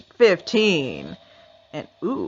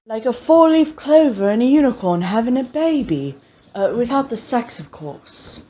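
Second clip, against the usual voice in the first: quoting Lucifer; 3 semitones higher than usual. Retold or imitated.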